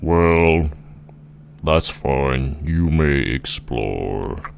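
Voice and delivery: slow, ponderous giant granting permission; big, drawn-out giant voice; mighty giant. Acted. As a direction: stretch the words out in a giant's voice. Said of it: "And then the giant says".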